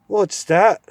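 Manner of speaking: high, loud cranky dwarf; deaf old dwarf. Acted. Deaf dwarf mishearing the owl, loud and cranky. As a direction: mishear it and bark back.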